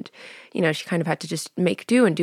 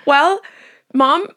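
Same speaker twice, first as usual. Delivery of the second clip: louder, higher, flustered introduction; awkward cover; 7 semitones higher, 5 dB louder, slower than usual. Retold or imitated. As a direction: bluff your way through it. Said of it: "I was like".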